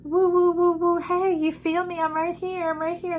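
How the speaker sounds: high playful calling voice; playful spirit calling out. Pretend. A spirit calling to the reader, high and playful. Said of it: "they're like".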